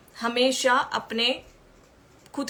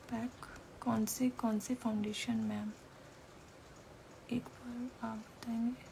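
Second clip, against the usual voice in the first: barely voiced reading of a question; reading to self; 14 dB quieter than usual. Real, not acted. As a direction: read the comment under your breath. Reading a viewer's question to herself.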